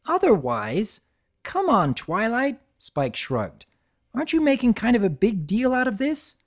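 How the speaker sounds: lightly voiced young dragon shrugging it off; Spike, dismissive. Spike brushing off Twilight's scolding, dismissive.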